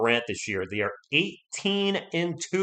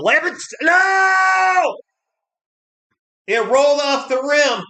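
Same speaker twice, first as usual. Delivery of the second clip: anguished shout; 13 semitones higher, 7 dB louder, much slower than usual. Real, not acted. Game-winning layup misses.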